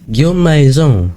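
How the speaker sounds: imitation lente d'un accent anglais; « Guillaume Maison » étiré à l'anglaise, comme la voix du jeu; accent british. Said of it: "avec son accent british". (imitation légère de l'accent anglais)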